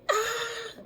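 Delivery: high startled shriek; scream again. Retold or imitated. Shrieking at the thing in the house. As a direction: let out a sudden frightened shriek. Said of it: "And so I was like".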